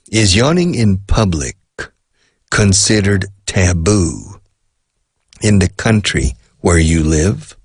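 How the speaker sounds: slow question with pauses between phrases. Shown as a demonstration. Teacher repeats the question for learners who didn't understand, slowed down. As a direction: spell the question out slowly, chunk by chunk. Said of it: "I will slow down".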